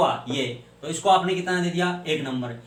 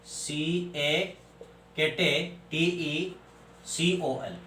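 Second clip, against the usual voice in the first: spelled out; 4 dB quieter, much slower than usual. Shown as a demonstration. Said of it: "catechol".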